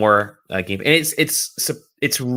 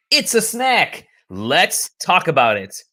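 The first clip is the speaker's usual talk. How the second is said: announcer voice; 7 semitones higher, slower than usual. Acted. Show catchphrase announced.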